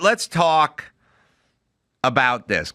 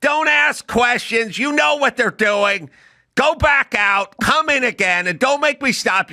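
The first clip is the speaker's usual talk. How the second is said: retold yelling; 11 semitones higher, slower than usual. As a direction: bark the notes at the student. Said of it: "And she'd yell".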